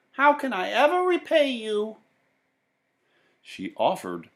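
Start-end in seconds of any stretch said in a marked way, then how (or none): 0.1-2.0 put-on grateful old woman. A narrator's reading. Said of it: "she said".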